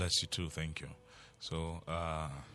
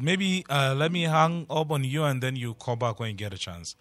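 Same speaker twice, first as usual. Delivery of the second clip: loud; 7 semitones higher, 9 dB louder than usual. Real, not acted. Host straining over bad line.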